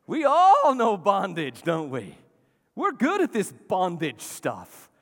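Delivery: loud, slow preacher's build; full-force sermon cadence; preaching. Real, not acted. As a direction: preach it out, rising.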